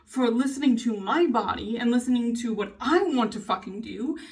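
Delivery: real indignant rant, pitch riding up; heated rant. Real, not acted. Venting about a family member's jab, heated. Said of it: "This is a little rant, little heated".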